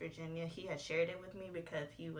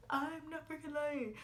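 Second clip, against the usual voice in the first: mock boyfriend denial; 8 semitones higher than usual. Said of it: "And he's like".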